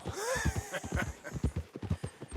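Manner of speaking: airy chuckle at his own joke; breathy laugh. Real, not acted. Outlaw laughing at his own pun, breathy laugh. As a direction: let a soft laugh out after the punchline.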